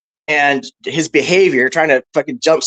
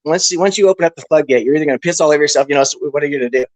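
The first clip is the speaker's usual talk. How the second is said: ordinary talk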